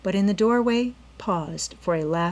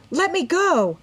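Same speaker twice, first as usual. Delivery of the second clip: much higher, desperate cry; Nancy crying out in the robber's grip; 11 semitones higher, 5 dB louder than usual. A narrator's reading. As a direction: cry out while struggling. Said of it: "Nancy cried".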